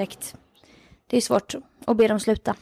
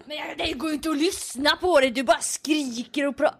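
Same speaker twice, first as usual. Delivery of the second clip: higher, scolding mock caller voice; angry caller; 3 semitones higher than usual. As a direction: berate her like a furious listener. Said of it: "Hon bara". (en arg lyssnare som skäller ut programledaren, härmat)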